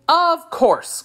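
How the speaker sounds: high-pitched cry of sudden realization; excited cry. A narrator's reading. Jackal pretending to grasp the tiger's correction, excited cry. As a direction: exclaim it high, as if it all makes sense. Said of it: "cried the jackal".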